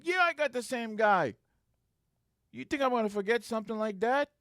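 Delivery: high, insistent comeback; retold indignant retort. Supervisor answering doubting troopers, acted indignant insistence.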